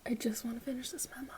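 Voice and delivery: frustrated whisper. Real, not acted. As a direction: whisper it in exasperation. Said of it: "I'm cranky".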